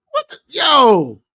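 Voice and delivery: stunned, stretch it out slowly; shocked yo. Real, not acted. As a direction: caught off guard by the chat. Reacting to chat comment.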